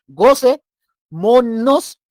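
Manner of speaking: drawn-out, loud, high "Gocémonos" called out; joyful call. Shown as a demonstration. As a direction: stretch "Gocémonos" like calling the crowd to party. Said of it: "y hacemos".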